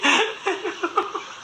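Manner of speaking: shrieking high-pitched laughter. Real, not acted. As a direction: cackle wildly. Laughing at her own retort.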